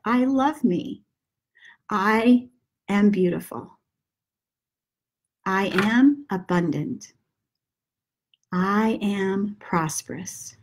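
gentle breathing-guide murmur; guided meditation. Acted. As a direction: ease them into breathing, hushed and unhurried. Repeats mantras for listener.

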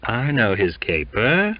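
ordinary delivery, nothing marked

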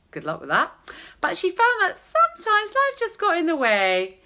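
ordinary delivery

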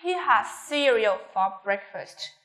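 slow teacher's model, "breakfast" going down; falling intonation. Shown as a demonstration. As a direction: drop the voice at "breakfast". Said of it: "chúng ta sẽ xuống giọng".

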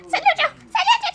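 high panicked plea; pleading for help. Acted. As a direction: beg to be pulled out. Trapped, crying for help.

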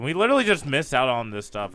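ordinary talk

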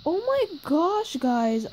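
ordinary talk

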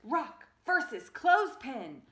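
loud, high fight announcer; big arena announcement. Acted. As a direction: call out the matchup like a ring announcer. Announcing the matchup.

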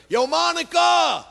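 hollered street announcement; yelling across tracks. Retold or imitated. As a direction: call out to Monica on the far platform. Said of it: "This guy screams across the tracks".

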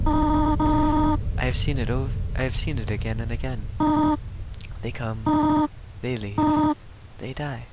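lightly voiced motherly goat queen, soft and slow; a hint of a stern mother; Toriel voice. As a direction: read it like a gentle but firm guardian. Reads Toriel's lines in voice.

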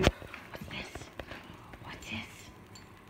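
hushed, unvoiced teasing whisper; soft secretive whisper; excited whisper. Real, not acted. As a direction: whisper it to intrigue the dog. Coaxing dog toward the toy.

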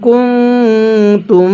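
কুনতুম with the ন hummed through the nose; nasalized ghunnah. Shown as a demonstration. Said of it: "নুন সাকিনটা আমরা গুনাহ করে পড়ব".

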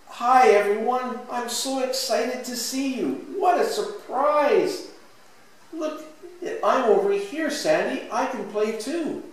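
put-on high, eager little-kid greetings; thrilled storybook child voice; excited child. A narrator's reading. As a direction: call out to playmates, pitched well up. Excited child figures greet each other.

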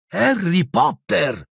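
high, drawn-out announcement; slow, elated exclamation; excited chorus reply. A narrator's reading. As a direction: stretch the name out in high-pitched wonder. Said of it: "risposero in coro i gemelli".

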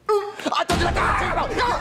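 high screeching yell; shrieking yell. Acted. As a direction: shriek at the kid. Shrieking at kid.

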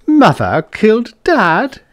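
high, slow, stunned gasp of a question; a daughter reeling at news of her father; shocked disbelief. A narrator's reading. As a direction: she can't believe what she just heard. Nicky shocked at family revelation.

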